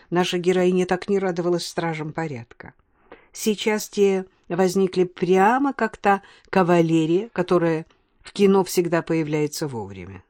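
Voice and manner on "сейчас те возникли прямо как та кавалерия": ordinary delivery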